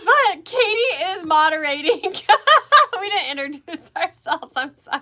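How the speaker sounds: high, loud, giggly delivery; laughing while talking. Real, not acted. Host realizing introductions were forgotten, laughing. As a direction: crack up through the words.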